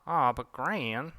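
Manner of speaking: slow, drawn-out whine; whiny kid. Acted. Jake pleading with Gran, whiny. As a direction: whine like a kid denied a treat.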